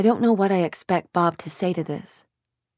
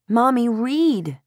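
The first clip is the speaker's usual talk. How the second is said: put-on slow, high child's plea; little girl begging; 7 semitones higher, much slower than usual. A narrator's reading. Little Lucy pleading slowly with her mother to read. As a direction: drag it out like a sleepy kid.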